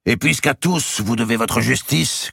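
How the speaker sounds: high and loud, rising on "puisqu'à"; Robert's plea. A narrator's reading. Robert pressing the king for justice, high and loud. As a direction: press the demand before the court. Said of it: "continua-t-il".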